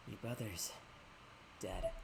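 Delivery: low, soft, ominous voice; grim figure voice. Acted. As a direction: deliver the death news darkly. Voicing ghostly figure in game.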